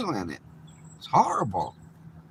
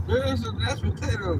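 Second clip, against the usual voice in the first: much higher, exaggerated server offering mash; mock pub server; 11 semitones higher than usual. Mocking a British pub server who serves mashed potatoes, high put-on voice. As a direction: ham up a pub waiter plonking down mash.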